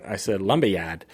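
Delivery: "lumber yard" as "lumbah yahd", with no R sounds; thick New England accent on "lumber yard"; hardcore New England accent. Shown as a demonstration. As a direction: drop every R in "lumber yard" the Boston way. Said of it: "I said it hardcore with a New England accent".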